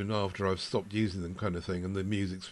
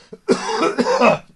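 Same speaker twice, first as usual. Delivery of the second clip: cough; 12 dB louder than usual. Pretend. Joke about Siri and coughing.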